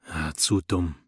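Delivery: soft, deflated sigh of disappointment. A narrator's reading. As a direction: let the words sink out on a sigh. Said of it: "seufzte Paolo".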